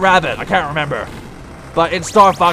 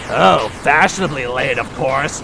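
lower, mock-mature woman impression; mocking forty-year-old woman; 5 semitones lower, 3 dB quieter than usual. Player mocks Nightmare Chica's line, mock older-woman voice. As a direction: mimic her as an affected middle-aged lady. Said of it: "sound like a forty-year-old woman".

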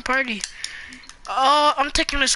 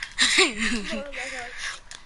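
soft laugh; 6 dB quieter than usual. Real, not acted. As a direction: laugh softly at the game. Laughs during the match.

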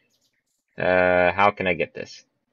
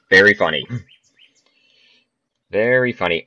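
sarcastic; 2 semitones higher, 5 dB louder, faster than usual. Real, not acted. Game mocks him.

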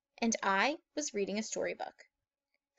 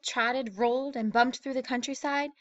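ordinary delivery, nothing marked

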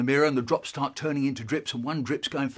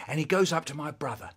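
plain reading voice

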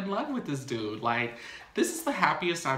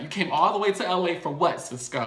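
faster, higher and louder demand; angry repeat; 6 semitones higher, 5 dB louder than usual. Retold or imitated. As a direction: throw the question back at Cisco in anger. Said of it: "She's like".

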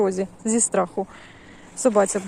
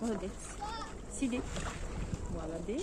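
talking to a dog; 18 dB quieter than usual. Real, not acted. Soft praise to dog.

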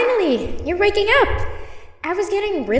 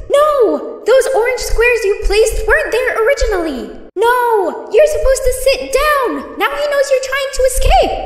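high, loud panicked cries of no; panicked shouting; 9 semitones higher, 5 dB louder than usual. Acted. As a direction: shout in full alarm as everything goes wrong. Robots panic as plan fails.

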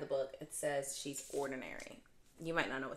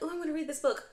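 high, loud excited self-quote; eager reader; 12 semitones higher, 6 dB louder, faster than usual. Retold or imitated. Mocking her own habit to viewers, eager and high. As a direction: gush like a reader grabbing a new book. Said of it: "I am that type of person".

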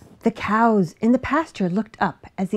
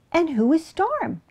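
cat asking; 7 semitones higher than usual. A narrator's reading. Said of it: "Cat asked".

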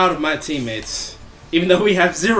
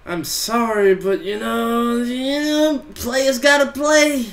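mocking taunt; 9 semitones higher than usual. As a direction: fake pity to rub it in. Mocking a beaten enemy player.

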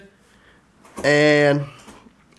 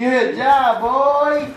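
excited praise; 7 semitones higher, much slower than usual. Real, not acted. Cheering baby on, laughing.

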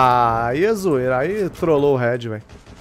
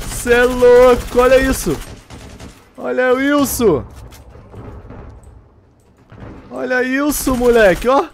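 high, loud amazed exclaim; amazed hype; 7 semitones higher, 5 dB louder than usual. Real, not acted. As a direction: marvel loudly at the play.

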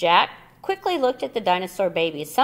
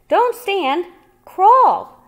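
urgent loud command; 13 semitones higher, 11 dB louder, much slower than usual. A narrator's reading. Urgent instruction.

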